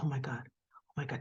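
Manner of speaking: soft, low murmured dismay; hushed dismay. Retold or imitated. Patient realizing she passed out, hushed dismay. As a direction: say it under your breath, imitating her shock. Retells waking woman's dismayed reaction.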